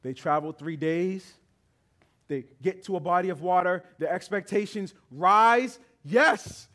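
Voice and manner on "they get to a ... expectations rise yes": rising, louder preacher's build; preaching at full force. Acted. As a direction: preach it with growing fire.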